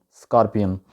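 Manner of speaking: स्कॉर्पियन with an open "ar" vowel, skar-pian; native English pronunciation. Shown as a demonstration. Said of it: "actual तलफ़ुज़ है गोरों वाला".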